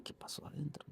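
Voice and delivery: hushed whisper, barely voiced. A worried father whispers outside the burns ward. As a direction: whisper it under your breath, worried. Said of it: "y dije".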